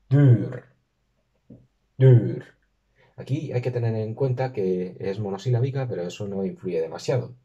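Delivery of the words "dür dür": "Dür" with a long rounded ü like German umlaut; teacher modelling the Sindarin for man; long rounded vowel. Shown as a demonstration. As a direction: stretch the ü in "Dür", lips rounded. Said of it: "sino como una I o U redondeada".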